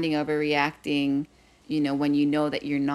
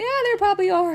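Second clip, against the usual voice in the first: teasing dad; 4 dB louder, much faster than usual. Retold or imitated. Dad teasing daughter about sharks.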